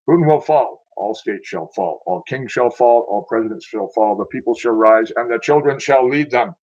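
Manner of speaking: loud, prophetic declamation; preaching. Real, not acted. Speaker prophesying the fall of rulers, preaching. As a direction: proclaim it like scripture, hammering each rising phrase.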